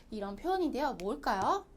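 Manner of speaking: usual speaking voice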